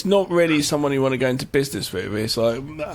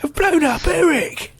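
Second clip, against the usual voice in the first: mock shout; 13 semitones higher, 3 dB louder than usual. Hammed-up reaction.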